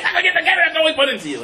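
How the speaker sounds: very high, strained, outraged scolding; acted anger. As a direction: rage at a hopeless younger brother. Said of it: "brother is so angry at him".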